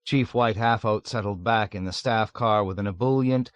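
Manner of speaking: usual speaking voice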